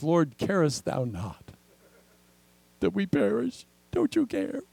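rising, desperate plea that climbs high on care; pleading cry. Retold or imitated. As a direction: disciples begging in the storm, pitch soaring. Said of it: "It says".

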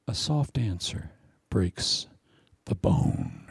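hushed, slow whisper; a preacher showing the congregation a soft answer, slow whisper. Shown as a demonstration. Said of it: "lower your voice a bit".

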